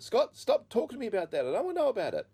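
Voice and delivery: high, loud exasperated retort; fed-up mother. Retold or imitated. Mother tells son to stop talking about Jesus, acted fed up. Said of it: "she just got really sick of it".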